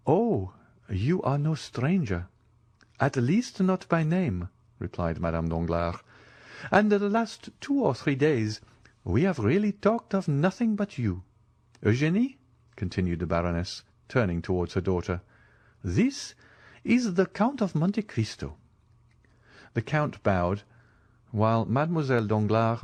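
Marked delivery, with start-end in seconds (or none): 0.1-4.5 gracious lady's voice. Acted. Baroness answers the Count graciously. 6.7-12.4 lightly voiced society lady. Baroness voiced by male narrator. 15.8-18.5 lightly voiced society lady. Baroness introduces the Count.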